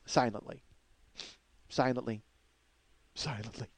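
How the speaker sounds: silently, silently, silently, trailing into a near whisper; fading to silence. Shown as a demonstration. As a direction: let the word die away on each repeat. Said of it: "standing there silently".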